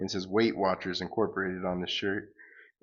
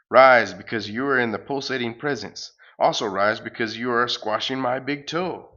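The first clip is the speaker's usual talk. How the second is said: booming cosmic salesman; 2 semitones higher, 7 dB louder than usual. Acted. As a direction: boom it out like a cosmic pitchman. Galacticus parody speaks.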